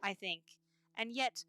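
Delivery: usual speaking voice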